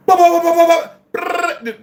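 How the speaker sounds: high, loud mouth gunfire sounds; gunfire noises. Retold or imitated. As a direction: rattle off gunshot noises with your voice. Imitating gunfire in the mountains.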